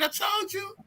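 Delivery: usual speaking voice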